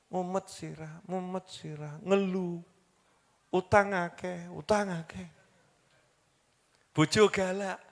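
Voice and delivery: a sighing mock complaint under the breath; muttered complaining. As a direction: mutter it like a tired, grumbling complainer. Voicing people's muttered complaints.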